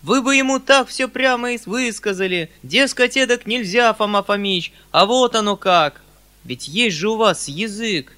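громкий высокий напор; подстрекай мужиков, крича с жаром; запальчивый крик. A narrator's reading. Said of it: "закричал я в запальчивости". (горячая запальчивая речь)

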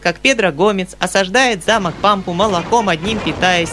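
put-on small, clear, high little boy's voice; even singsong schoolboy recital; child's rote recitation. A narrator's reading. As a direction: rattle off the verses without commas or stops. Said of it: "ровным и ясным голосом, без запятых и без точек".

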